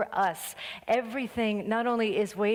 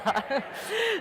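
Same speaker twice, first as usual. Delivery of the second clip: high laugh; 12 semitones higher than usual. Real, not acted. Laughs after song.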